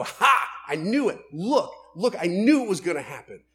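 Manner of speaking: loud, high gloating crow; mock gloating I-told-you-so. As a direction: crow over his fall. Gloating over someone's failure.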